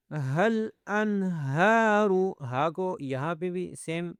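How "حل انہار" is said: انہار with a long "haa" from the chest; slow tajweed reading of انہار; chest haa recitation. Shown as a demonstration. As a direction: chant حل انہار slowly, stretching the alif. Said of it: "سینے سے آواز نکالیں گے".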